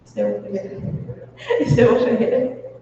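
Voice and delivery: laughing through the name. Real, not acted. Speaker cracks up at a name. (rindo ao falar)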